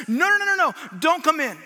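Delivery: high, loud string of hurried protests; head someone off at the door; urgent stopping. Acted. Friends rush out to stop Jesus.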